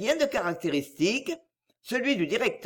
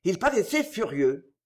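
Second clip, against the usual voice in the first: usual speaking voice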